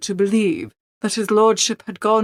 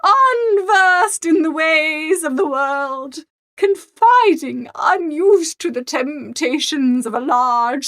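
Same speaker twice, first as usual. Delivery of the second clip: higher, slow, reproachful grande dame; high, deliberate scolding voice of an offended aristocrat; indignant Lady Morvern; 6 semitones higher, slower than usual. Acted. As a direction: look down on him as the haughty aunt, slowly.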